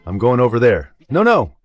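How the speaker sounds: normal talking voice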